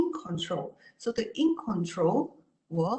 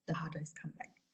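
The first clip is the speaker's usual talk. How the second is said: whispered warning; 2 semitones lower, 12 dB quieter, faster than usual. Real, not acted.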